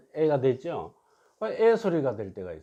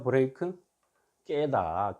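usual speaking voice

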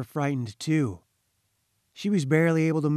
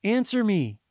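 calling out; 8 semitones higher than usual. A narrator's reading. Mother calling to silent Anne-Marie, pleading call. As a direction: call to the child to answer. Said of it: "she called".

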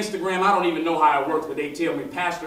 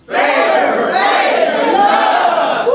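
high, loud preacher shout; 11 dB louder, much slower than usual. Real, not acted. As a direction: belt out the slogan. Shouting the closing slogan.

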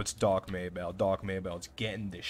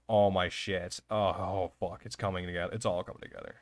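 usual speaking voice

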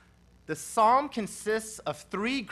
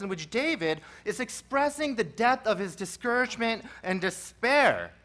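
usual speaking voice

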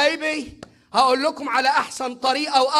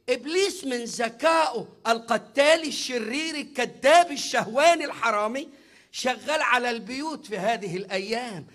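ordinary talk, nothing marked

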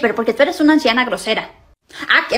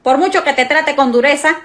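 ordinary delivery

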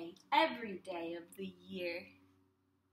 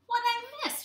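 high, louder questioning mom; mom asking; 11 semitones higher, 6 dB louder, faster than usual. Acted. Mom asking about the kitchen mess. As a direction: ask it like a curious mom. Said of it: "she asked".

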